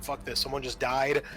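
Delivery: ordinary delivery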